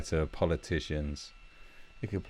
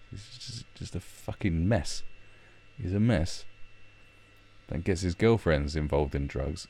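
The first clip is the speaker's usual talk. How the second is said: normal talking voice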